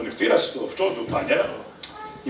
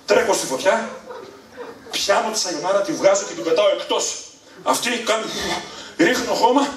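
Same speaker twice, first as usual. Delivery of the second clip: normal talking voice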